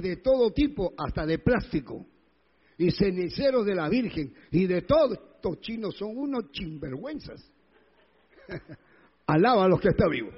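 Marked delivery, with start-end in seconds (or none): none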